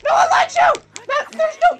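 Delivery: very high, loud hammed-up shrieks; panicked screaming. Gamer fending off a coming hand, hammed-up screaming. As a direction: shriek it in over-the-top panic.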